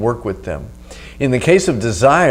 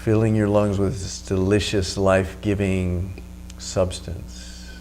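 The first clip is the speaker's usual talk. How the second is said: right in your ear; 3 semitones lower, 7 dB quieter than usual. Acted. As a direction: close and calm, guiding the breath. Leads a breathing meditation.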